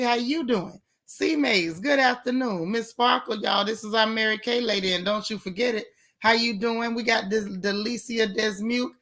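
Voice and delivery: ordinary delivery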